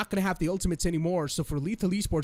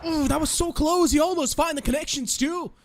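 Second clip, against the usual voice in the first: high, loud, startled hype; excited; 10 semitones higher, 4 dB louder than usual. Real, not acted. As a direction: gasp at a near miss. Near miss in live cast.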